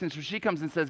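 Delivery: normal talking voice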